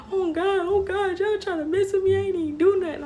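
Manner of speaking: high, loud whiny girl imitation; mock panicked girl. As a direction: wail it like an accused girl. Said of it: "She was like".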